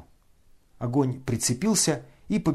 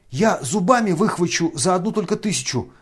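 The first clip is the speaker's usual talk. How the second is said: eager offer; 5 semitones higher, 5 dB louder than usual. A narrator's reading. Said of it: "предложил было Фердыщенко".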